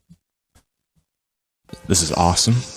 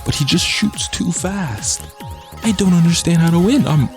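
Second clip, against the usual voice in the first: frustrated whine; 11 semitones higher than usual. Real, not acted. Losing a game, frustrated.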